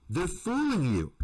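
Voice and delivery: ordinary talk, nothing marked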